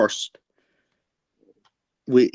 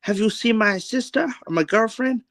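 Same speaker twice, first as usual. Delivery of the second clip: high-pitched mock Chinese accent; put-on Chinese-accented question, pitched well up; Chinese guy; 9 semitones higher than usual. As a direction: ask in a caricatured Chinese accent. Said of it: "the Chinese guy goes".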